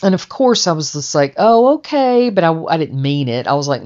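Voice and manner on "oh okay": mock okay. Mock agreeable reply.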